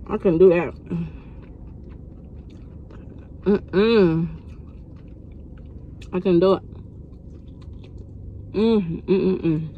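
talking between bites; muffled chewing speech; mouth full, chewing. Real, not acted. Seen 4: Holds fried chicken, chews and takes bites while talking throughout.

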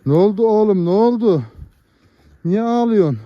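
high sing-song fuss over a crying newborn calf. Real, not acted.

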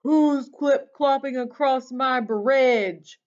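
booming, drawn-out troll demand; troll's roar. A narrator's reading. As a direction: roar a slow challenge at the intruder. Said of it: "A roar rose up from below".

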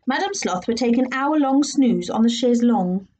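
plain reading voice

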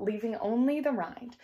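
plain reading voice

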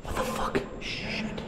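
hushed whispered cursing; alarmed whisper. Real, not acted. Explorer hiding from a threat, alarmed whisper. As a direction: swear under your breath.

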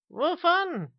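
very high, loud yelp; startled, high-pitched cry; alarmed question. Acted. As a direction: blurt the question in a squeal of panic. Smith asks in alarm.

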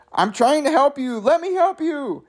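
very high-pitched little critter; small raccoony voice. Acted. Raccoon pleading under the office door, small high voice. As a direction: squeak it out like a tiny raccoon. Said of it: "say in small raccoony voice".

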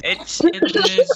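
put-on high, slowed Indian accent; faltering Indian accent impression; mock Indian accent. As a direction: stretch it in a joke Indian accent. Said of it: "Indian accent".

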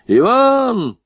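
high drawn-out call for a servant. A narrator's reading. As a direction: shout the name, stretched and high. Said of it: "крикнул он денщика".

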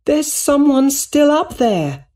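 very high, slow, loud shout. A narrator's reading. Woman warning that someone is still inside, shouting. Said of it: "a woman shouted".

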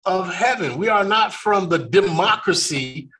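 ordinary talk

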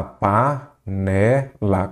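PANELA broken slowly into pa, ne, la; syllable by syllable. Shown as a demonstration. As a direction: say panela one separated syllable at a time. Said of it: "você separa em sílaba". (panela dita devagar, sílaba por sílaba)

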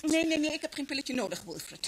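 indignant refusal; irritated refusal. Acted. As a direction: snap back at the pill offer. Mother refuses pill from Wilfred.

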